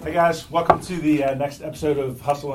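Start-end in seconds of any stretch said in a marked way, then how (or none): none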